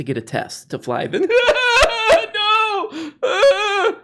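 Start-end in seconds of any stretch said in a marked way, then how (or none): 1.3-2.3 high loud fake sob; mock wail. Mock seminar speaker bursting into a fake high sob. 2.3-3.9 high hammed-up cry of no; mock tantrum. Mocking an adult crying at the airport, high shriek.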